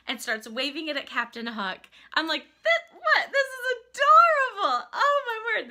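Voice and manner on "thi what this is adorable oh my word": very high, loud gushing; retold overwhelmed delight. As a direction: gush as if nearly moved to tears. Retells overwhelmed delighted reaction.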